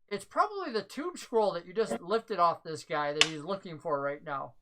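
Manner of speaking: ordinary talk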